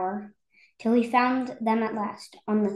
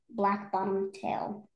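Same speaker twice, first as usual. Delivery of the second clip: plain reading voice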